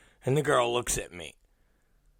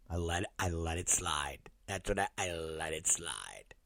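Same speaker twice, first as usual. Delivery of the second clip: low, very soft and held back; quiet, low-pitched resignation; subdued restraint; 9 semitones lower, 14 dB quieter than usual. Retold or imitated. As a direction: keep it down, swallowing the reaction. Holding back his reaction, retelling.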